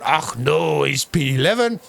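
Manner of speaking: hammed-up Scottish lilt on the groan; bad Scottish accent. Commentator mocks Scottish driver Chris in a bad Scottish accent. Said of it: "Terrible Scottish accent, that".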